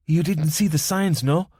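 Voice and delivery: lightly voiced Spanish accent; young woman, soft Spanish accent. A narrator's reading. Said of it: "in a soft Spanish accent".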